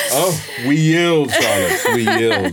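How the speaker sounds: laughing mock surrender; giggling, bowing down in jest. Mock bowing to a triple threat.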